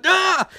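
high-pitched comic yelp; hammed-up cry of alarm; mock scream. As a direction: yelp high, as if dreading a fall. Voicing Barry reacting to warning.

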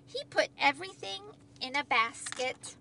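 ordinary delivery, nothing marked

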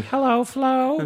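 very high, slow squeak; high squeaky voice. Retold or imitated. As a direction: stretch it out in a tiny high voice. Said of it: "I'm doing".

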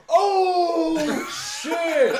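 high, loud shocked yell from the crowd; retold shout. Crowd behind the barricade yelling as he gets kneed. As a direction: yell it like onlookers seeing a hit. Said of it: "all I hear is ... just go".

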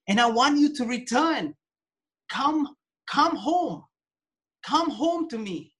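pleading plea. Acted. God voiced pleading for return.

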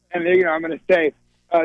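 normal talking voice

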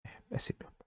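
near whisper, words fading out; half-whispered. Real, not acted. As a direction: mutter it almost unvoiced. Drops voice, almost whispered.

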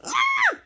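very high falling yelp; sudden high shriek. Acted. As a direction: cry out as if struck down. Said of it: "he was like".

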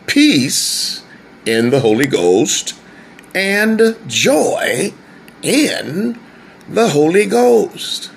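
peace and joy lifted high in pitch; rising preacher emphasis on joy; emphatic restatement. Shown as a demonstration. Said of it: "Let me say it like this".

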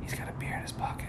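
imitating a hushed tip-off; secretive whisper. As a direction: whisper it low, like passing on a secret. Confides a secret about hidden beer.

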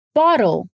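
careful American "bottle", light flap in the middle; flap T drill. Shown as a demonstration. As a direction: say "bottle" for repeating, t tapped like D. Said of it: "o mesmo som de D mais suave".

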